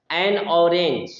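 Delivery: slow, "एन" given full weight; stress on an. Shown as a demonstration. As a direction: stress "एन" before orange. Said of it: "जोर दिया". ("एन" पर ज़ोर, धीमे)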